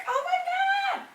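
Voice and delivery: high delighted squeal; excited squeal. Real, not acted. Curtains fully closed.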